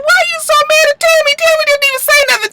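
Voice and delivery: fast, very high mock protest; squeaky disbelief. Viewer questioning Jessica's anger at Tammy, squeaky disbelief. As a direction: rattle it off in a squeal. Her high-pitched baffled reaction.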